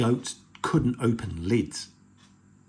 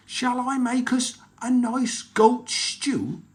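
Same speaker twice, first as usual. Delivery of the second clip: slow, high troll voice; troll proposing goat stew to pirates; sly troll; 12 semitones higher, slower than usual. Acted. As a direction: offer it with relish.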